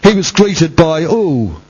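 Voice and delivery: high, intoned, almost sung preaching over music; full-force preaching. Acted. Preacher crying out Christ's last words to the church, intoned and high. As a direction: soar into a chanted sermon climax.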